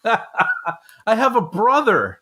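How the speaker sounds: high, very slow, laughing through the line; laughing movie quote. Retold or imitated. Host quotes Prince of Thieves to cohost, laughing and slowed. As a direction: drag the line out while cracking up.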